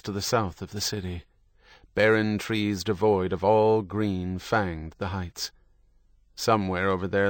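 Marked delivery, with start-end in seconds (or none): none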